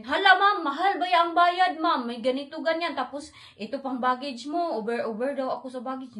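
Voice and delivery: high, loud mocking airline clerk; mocking clerk demanding costly rebooking fees; mocking staff voice. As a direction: ham up a greedy clerk.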